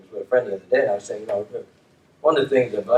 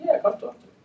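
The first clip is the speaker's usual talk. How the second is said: eager quoted reply; 11 semitones higher, 5 dB quieter than usual. Retold or imitated. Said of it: "I said".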